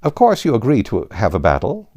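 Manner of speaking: ordinary delivery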